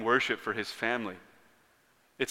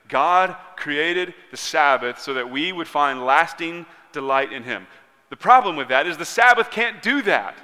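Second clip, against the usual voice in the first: high, loud preaching, building in force; impassioned preaching; 6 semitones higher, 9 dB louder than usual. Real, not acted. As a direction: press the point home with rising pulpit intensity.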